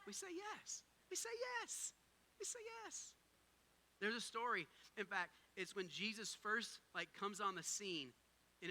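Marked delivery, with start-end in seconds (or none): 0.1-3.1 high, soft, lifted chant; call and response. Real, not acted. Pastor leading viewers online in a high soft chant. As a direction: lead them in the refrain. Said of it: "say it with me".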